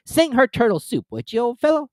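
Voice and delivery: high, loud, coaxing creature; a high, loud Griffin. A narrator's reading. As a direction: ask the old friend. Griffin asks turtle.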